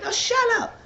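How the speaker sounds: squealed, very high no shut up; high-pitched squealing denial; mock disbelief. Girl denies in disbelief.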